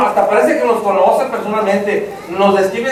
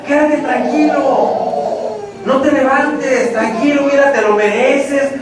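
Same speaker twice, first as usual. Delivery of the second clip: slow, higher, drawn-out soothing temptation; coaxing inner voice; 5 semitones higher, slower than usual. Acted. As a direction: stretch it out and coax them to stay put. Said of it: "usted escuchó una vocecita que le dice".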